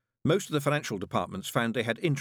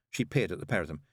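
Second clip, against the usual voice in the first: plain reading voice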